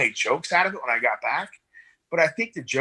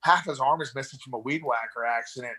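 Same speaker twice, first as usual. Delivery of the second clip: ordinary delivery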